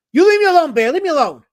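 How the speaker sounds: high, loud hammed-up outburst; mock outraged yell. Mock outburst at chatter Bear.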